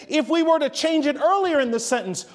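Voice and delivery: ordinary delivery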